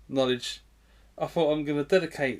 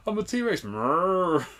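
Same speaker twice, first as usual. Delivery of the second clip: mock T-Rex growl; 5 semitones higher, slower than usual. Playing T-Rex.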